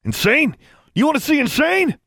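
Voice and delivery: high, wild, furious challenge; snarled. A narrator's reading. Rescuer throws the word back at the bully, snarling. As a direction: snarl the question, pitch climbing. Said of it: "Maximilian snarled".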